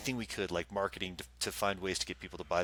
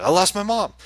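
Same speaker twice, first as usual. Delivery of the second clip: panicked cry; 11 semitones higher, 11 dB louder than usual. Retold or imitated. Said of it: "I'm like".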